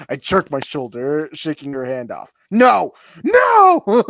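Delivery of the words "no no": high, very loud refusal; hammed-up scream, second one higher; furious yell. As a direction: yell it in mock fury. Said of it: "I let out a furious yell".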